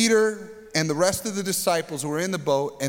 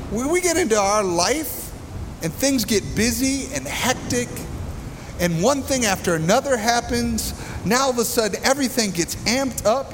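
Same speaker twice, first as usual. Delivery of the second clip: high-pitched, projecting over the noise; raised preaching voice; 6 semitones higher than usual. Real, not acted. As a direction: preach urgently over a roaring storm. Preaching over loud storm noise.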